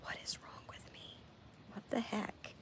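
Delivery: hushed, breathy whisper, as if muttering to herself. Retold or imitated. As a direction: whisper the question under your breath. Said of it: "and I'm like".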